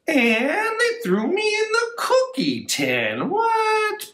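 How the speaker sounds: high squeaky mock shock; mock outrage. Librarian hamming the rhyme's punchline, high mock shock. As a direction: play up the silly surprise.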